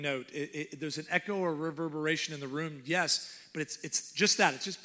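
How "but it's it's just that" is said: usual speaking voice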